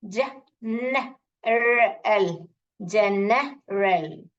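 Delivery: जनरल very slowly, each sound apart; broken into syllables. Shown as a demonstration. Teacher asks children to break the word, slow segmented जनरल. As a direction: split जनरल into ज, न, रल with pauses. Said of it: "ऐसे break करके पढ़िए".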